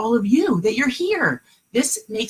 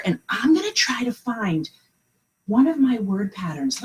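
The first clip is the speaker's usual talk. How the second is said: ordinary talk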